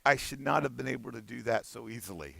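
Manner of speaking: low, soft defeated mutter to himself; defeated inner voice. Retold or imitated. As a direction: sound beaten by how easy it was. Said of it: "it is the sigh of a person going".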